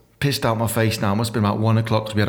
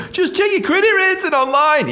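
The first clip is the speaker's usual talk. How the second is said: high, chirpy advert jingle read; mock a cheesy TV advert; sing-song commercial parody; 4 dB louder than usual. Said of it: "you know how they go".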